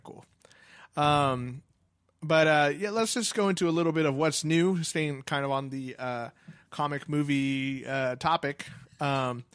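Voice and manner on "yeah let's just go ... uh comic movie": ordinary talk, nothing marked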